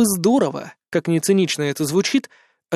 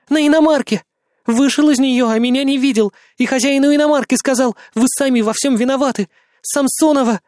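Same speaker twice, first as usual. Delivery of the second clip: panicked retelling; 8 semitones higher, 4 dB louder than usual. Acted. Frightened woman retells in panic.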